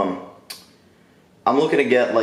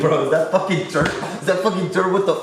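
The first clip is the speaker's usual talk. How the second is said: shocked disbelief; 6 semitones higher than usual. Real, not acted. Shocked at the dropped food.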